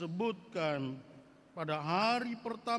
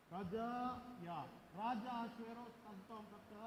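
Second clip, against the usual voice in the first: hushed aside; 15 dB quieter than usual. Shown as a demonstration. Hushed retelling of the court scene.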